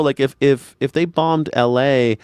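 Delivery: ordinary delivery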